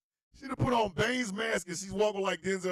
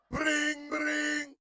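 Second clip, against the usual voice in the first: high, sustained ringing imitation; mimicked telephone trill; phone ring; 9 semitones higher than usual.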